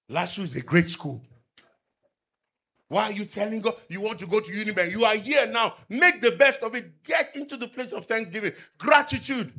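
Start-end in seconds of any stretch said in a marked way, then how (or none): 2.9-9.5 raised, louder preaching voice; fervent sermon delivery. Acted. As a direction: proclaim it to the congregation. Exhorting the congregation.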